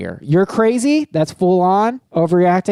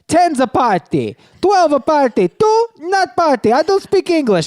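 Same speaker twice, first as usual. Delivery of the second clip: put-on Italian accent; 10 semitones higher than usual. Restaurant host brushing off a party of two, in a light Italian accent. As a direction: play the funny old Italian host with a hint of Italian accent. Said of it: "He's a funny old Italian guy".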